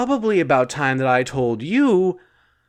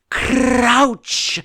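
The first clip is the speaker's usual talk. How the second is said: a high, loud bark of a monster's order; living hair barking its command; 9 semitones higher, 4 dB louder than usual. Acted. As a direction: snap it like a tyrant creature claiming a slave. Hair creature commands her.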